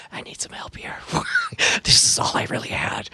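hushed, unvoiced aside; whisper. Player secretly asks Justin for help, whispering. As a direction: whisper it urgently to your partner. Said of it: "I whisper to Justin".

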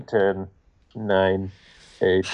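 low, evenly spaced countdown voice; slow, measured low count; countdown clock. Acted. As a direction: count down like an ominous game clock. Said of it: "You hear a clock going".

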